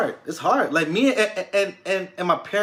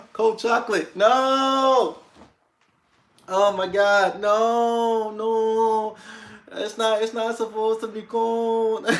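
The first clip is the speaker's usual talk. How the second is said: high, whiny mock outrage; mock dismay; 6 semitones higher, much slower than usual. Real, not acted. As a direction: wail about the cold drink. Mock dismay at cold drink.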